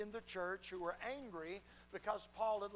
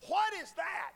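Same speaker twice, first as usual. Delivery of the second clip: put-on high, loud, demanding yell of an old lady; indignant shout; 11 semitones higher, 10 dB louder, slower than usual. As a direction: bark it out as a cranky elderly woman. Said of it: "in a voice like this".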